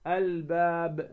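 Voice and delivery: الباب said slowly, stretched, lips closing firmly on ب; demonstrated ba. Shown as a demonstration. Teacher repeating the example for the lips, slow careful الباب. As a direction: draw out الباب and land on the final b. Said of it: "تقول الباب".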